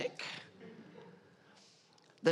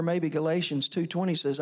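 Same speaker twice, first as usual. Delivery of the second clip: ordinary talk, nothing marked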